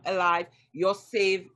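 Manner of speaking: ordinary talk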